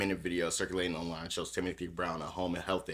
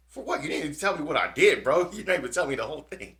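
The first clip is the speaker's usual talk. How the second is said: much higher and louder, exasperated; imitating the agent's disbelief, pitched way up; outraged protest; 9 semitones higher, 10 dB louder than usual. Imitating the arrested ATF agent protesting to the cop, high outraged voice. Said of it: "He's like".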